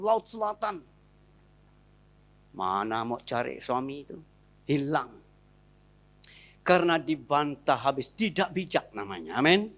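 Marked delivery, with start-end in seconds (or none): none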